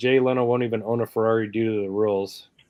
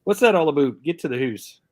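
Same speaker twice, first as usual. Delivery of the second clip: raised, jokey Canadian with hoose for house; mock Canadian; 5 semitones higher than usual. Mocking Canadians for her stream chat, Canadian accent. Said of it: "Call somebody an a-hole".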